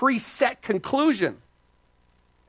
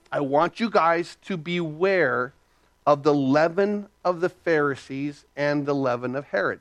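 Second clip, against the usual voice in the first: solemn warning; 5 semitones lower than usual. Retold or imitated. Acts out Jesus charging the disciples.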